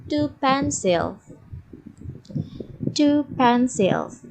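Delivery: two pencils said slowly for repeating; slow, careful teacher's model; model pronunciation. Shown as a demonstration. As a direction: give the class a slow phrase to copy. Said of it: "cara membaca yang baik dan benarnya".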